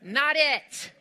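shouted no; loud, flat-out dismissal; emphatic. Real, not acted. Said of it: "emphatic rejection".

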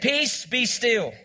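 slow, deliberate rebuke of the storm; calm, drawn-out command. Shown as a demonstration. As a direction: speak peace slowly, word by word. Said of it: "Say it".

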